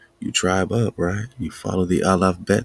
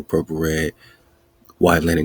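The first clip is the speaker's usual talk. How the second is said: ordinary delivery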